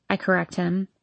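usual speaking voice

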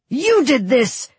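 high, slow, furious yell; screamed accusation. Acted. Vasily blaming Nikolai amid the attack, screaming. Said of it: "he screamed at Nikolai".

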